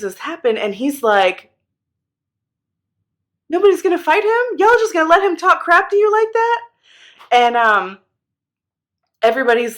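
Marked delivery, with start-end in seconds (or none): 3.5-6.6 indignant challenge. Retold or imitated. David outraged at the army.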